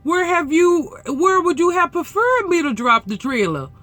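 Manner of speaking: high, loud mock-exasperated question; exasperated retort. Retorting to the other driver.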